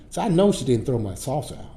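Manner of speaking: ordinary talk, nothing marked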